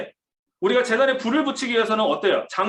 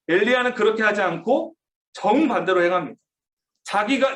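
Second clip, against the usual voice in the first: ordinary talk, nothing marked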